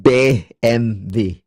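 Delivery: B M W slow and loud as "bay-em-vay"; corrected German pronunciation. Shown as a demonstration. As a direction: repeat B M W carefully the German way.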